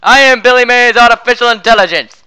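booming infomercial pitchman; shouting. Acted. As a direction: announce it at full volume.

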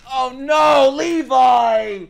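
loud, high cry of alarm; a sudden fan scream of Levi's name; real shout of alarm. Real, not acted. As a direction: yell his name at the screen in shock. Reacting to Levi on screen.

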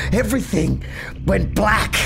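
ordinary delivery

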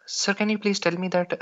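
normal talking voice

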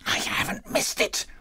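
whispered relief; marvelling to himself. A narrator's reading. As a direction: whisper it to yourself in wonder. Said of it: "said Scrooge to himself".

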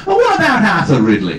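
high, loud mimicked New Zealand interviewer; New Zealand accent. Interviewer gets an actor's name wrong, imitated New Zealand accent. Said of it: "whether I can do the accent".

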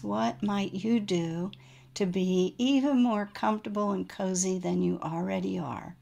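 ordinary talk

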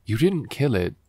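ordinary talk

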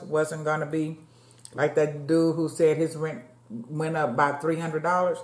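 normal talking voice

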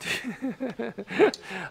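high, quiet chuckle; a short giggle of disbelief; exasperated laugh. Real, not acted. Laughs at own rising exasperation.

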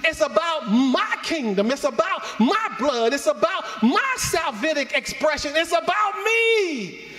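preacher voicing Jesus, building to a high shout. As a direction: let Jesus' voice swell to a shout. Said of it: "I can just hear him say it".

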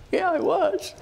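cheeky admission. Retold or imitated. Retold reply to officer.

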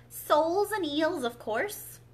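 impatient. A narrator's reading. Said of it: "the Gryphon replied rather impatiently".

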